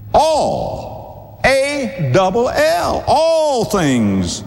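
"All, A double L" said high and slow; preacher spelling the word to stress it; spelled-out emphasis. Shown as a demonstration. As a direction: stretch "All" and spell it to hammer it home.

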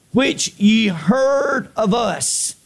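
very slow, drawn-out verse; deliberate scripture reading. Real, not acted. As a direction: stretch each word for the congregation. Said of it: "I'm gonna read it slow".

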